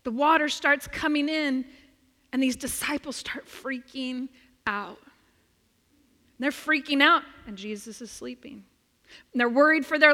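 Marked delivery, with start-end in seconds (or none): none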